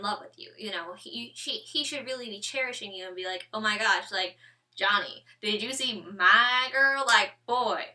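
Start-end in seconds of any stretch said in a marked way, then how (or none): 3.5-7.8 put-on loud, drawn-out hype for a girlfriend; proud bragging. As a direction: show off your girl, stretching the words out loud. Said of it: "He should be proud to have you".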